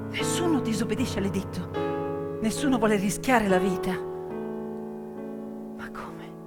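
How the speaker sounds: ordinary talk, nothing marked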